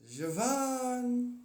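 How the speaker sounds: stretched "Javan", zh at the start; name pronunciation. Shown as a demonstration. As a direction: say "Javan" as zhuh-VAHN, high and long. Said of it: "That's how it's pronounced".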